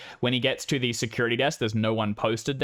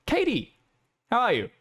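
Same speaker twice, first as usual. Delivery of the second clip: much higher, welcoming professor; warm professor; 13 semitones higher, faster than usual. Acted. Professor greeting the student at his door, high and welcoming. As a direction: sing out a welcome to Katie. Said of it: "he opens it and he goes".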